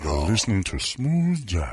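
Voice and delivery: slow, low radio DJ voice; lightly voiced Jeffrey Holder; smooth jazz DJ. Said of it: "turned into Jeffrey Holder".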